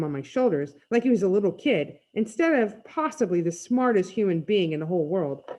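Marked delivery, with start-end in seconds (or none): none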